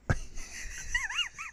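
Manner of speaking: high, shrieking laugh. Real, not acted. Laughing at own story.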